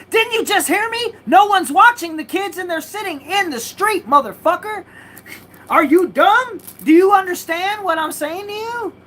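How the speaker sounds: yelling at the neighbor who won't listen, enraged; retold furious yell. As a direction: berate him as if he's dumb.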